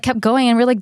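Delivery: ordinary talk, nothing marked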